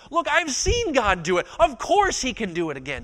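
high, loud testimony; impassioned confidence. Acted. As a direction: preach it with rising conviction. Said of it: "that's what David is getting at".

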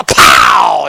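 low, loud shouted pow; explosive shouted bang; shotgun pow. Retold or imitated. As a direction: bark it out like a twelve gauge. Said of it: "sound like a twelve gauge going off".

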